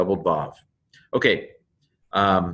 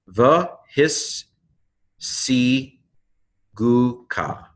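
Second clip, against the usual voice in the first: higher, separate syllables with clear pauses; 4 semitones higher, much slower than usual. Shown as a demonstration. Teacher breaking down a Hebrew word, syllable by syllable. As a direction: sound out "The his si gu ka" one piece at a time. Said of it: "ends the first syllable".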